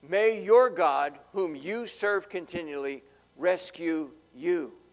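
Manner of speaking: loud, slow, earnest royal blessing; a king calling out his hope, slow and loud; king's loud blessing. Acted. Preacher as the king, calling loudly to Daniel. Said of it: "The king said to Daniel".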